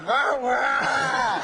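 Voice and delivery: hammed-up strained cry; imitating Larry Flynt. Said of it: "he said to me".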